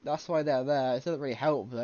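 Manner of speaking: ordinary talk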